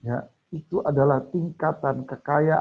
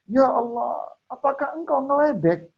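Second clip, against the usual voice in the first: high, disbelieving "are you teasing me"; incredulous plea; 9 semitones higher than usual. Acted. Man at heaven's gate protesting to God, high and disbelieving. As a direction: lift the voice high in amazed protest.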